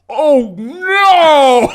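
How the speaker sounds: high, loud, laughing "Oh, no!"; a hero's panic right before impact; startled oh no. Acted. GM voicing the Flash seeing the horn, panicked cry. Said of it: "he looks at you and he goes".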